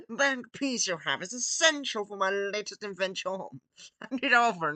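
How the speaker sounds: put-on villain accent with odd timbre; Gru impression. Villain demanding the piece, hammy accented voice. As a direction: play it as a hammy foreign supervillain. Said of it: "I can't do his voice".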